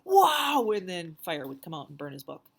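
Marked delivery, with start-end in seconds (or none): 0.0-0.7 loud, high burst of delight; excited wow. Acted. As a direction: shout it out in pure wonder. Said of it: "He'd go".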